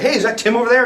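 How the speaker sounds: high shouted call across the room. Retold or imitated. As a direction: call out to spot him. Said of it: "I believe I yelled".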